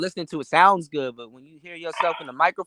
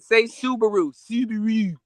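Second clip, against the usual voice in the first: Subaru in a higher, put-on Baltimore accent; 6 semitones higher than usual. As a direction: imitate a Baltimore local saying Subaru. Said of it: "I said, I said".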